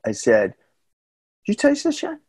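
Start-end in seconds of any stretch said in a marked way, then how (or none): 1.5-2.2 much higher, eager mock question; excited question. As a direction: pitch it way up, thrilled to share. Asking buddy about the chowder.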